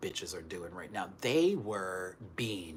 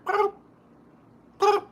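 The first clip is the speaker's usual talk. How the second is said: short, very high cat chirp; mimicked little cat "boop"; imitated cat noise; 7 dB louder than usual. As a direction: pop out two tiny high squeaks. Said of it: "and I hear".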